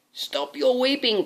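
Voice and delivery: high, bold knight calling out, slowed; a hero's rallying call; lightly voiced gallant knight; knight's cry. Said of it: "cried the knight".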